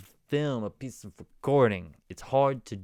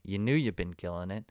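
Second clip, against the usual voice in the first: ordinary delivery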